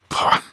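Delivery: loud dismissive scoff; scornful pah. Acted. Father scoffing at his son, a loud dismissive pah. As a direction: spit out a contemptuous pah.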